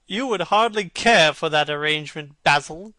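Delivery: amused cry to Basil; cried out laughing. A narrator's reading. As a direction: call it out with amusement. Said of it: "cried Lord Henry, laughing".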